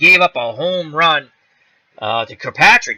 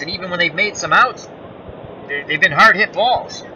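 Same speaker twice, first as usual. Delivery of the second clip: ordinary delivery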